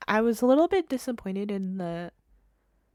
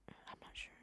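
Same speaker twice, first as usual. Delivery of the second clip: unvoiced, much softer confiding aside; whispered aside; 24 dB quieter than usual. Real, not acted. Telling viewers which team they backed, soft whisper. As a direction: drop to a secretive whisper.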